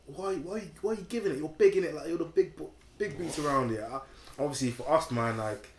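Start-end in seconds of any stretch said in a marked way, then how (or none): 0.1-3.8 higher-pitched mock challenge; retold confrontation. Man retells a chav confronting his group, higher-pitched challenge. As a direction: imitate a lad squaring up. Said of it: "And man was like".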